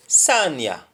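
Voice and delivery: "Sanya, fala baka me know" fast, in a Jamaican Patois lilt; a quick Patois call to follow. Shown as a demonstration. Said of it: "the example is".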